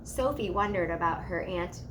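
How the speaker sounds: ordinary delivery, nothing marked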